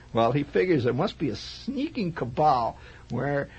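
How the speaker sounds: normal talking voice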